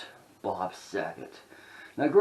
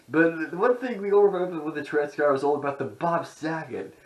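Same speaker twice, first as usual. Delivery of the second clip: usual speaking voice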